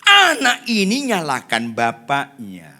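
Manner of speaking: loud, drawn-out, measured emphasis; slow emphatic repeat. Real, not acted. Preacher driving the point home, slow and loud. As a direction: stretch every word to drive it home.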